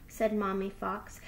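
plain reading voice

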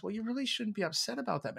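mock soothing, talking down; patronizing quote. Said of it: "somebody says".

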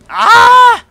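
startled scream. Real, not acted. Sudden fright on screen.

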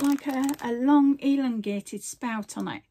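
normal talking voice

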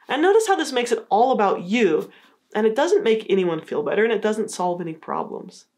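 usual speaking voice

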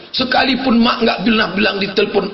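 normal talking voice

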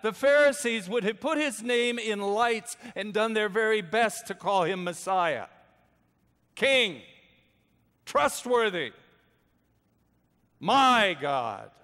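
crying out the praise the Pharisees would give, preaching loud; preaching at full force. Acted. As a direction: build the list to a ringing climax. Preacher building to a climax.

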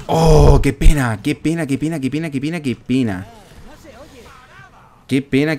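mock lament outburst. Real, not acted. Player reacting to the play, loud repeated lament fading off. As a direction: cry out, then chant it on, easing down.

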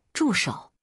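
lightly voiced angry halt; sharp shout. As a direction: cut him off with one stern word. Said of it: "暴喝一声".

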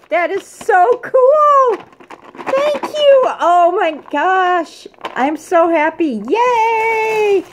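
high and loud, slow happy squeals; gushing thanks. Real, not acted. Thanking for the gifts.

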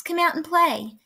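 high, inviting call. A narrator's reading. Mouse inviting Bear out of the trees, calling out. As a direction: lightly call a friend outside. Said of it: "called Mouse".

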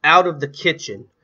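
loud, high order; mother at the end of her patience; impatient shriek. A narrator's reading. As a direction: shout them out of the room. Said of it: "ordered with an impatient shriek".

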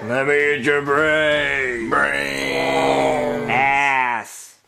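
slow, droning zombie moan; terrible zombie. Acted. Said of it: "you're a terrible zombie".

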